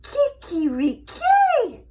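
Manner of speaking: high, loud, slow rooster crow; furious rooster crow. A narrator's reading. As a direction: crow it out in rage. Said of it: "the rooster was furious".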